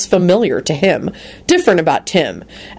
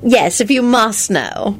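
threatening; 5 semitones higher, slower than usual. A narrator's reading. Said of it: "Rosie replied threateningly".

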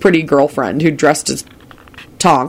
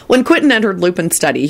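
plain reading voice

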